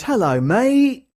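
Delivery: drawn-out, high mock Cockney greeting; mimicked London Cockney. Streamer voicing a viewer's typed greeting, mock Cockney. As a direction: put on a loud, stretched Cockney accent. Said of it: "trying to mimic the, uh, the London, the London Cockney geezers".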